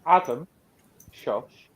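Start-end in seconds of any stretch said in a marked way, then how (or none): 0.1-0.5 shouting. Real, not acted. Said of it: "you shouted at Adam a little bit".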